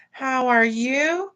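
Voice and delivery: ordinary delivery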